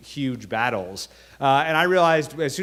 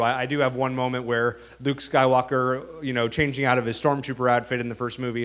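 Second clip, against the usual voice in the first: ordinary talk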